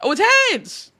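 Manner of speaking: excited. Real, not acted. Bet result announced.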